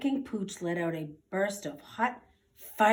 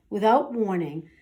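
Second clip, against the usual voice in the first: ordinary delivery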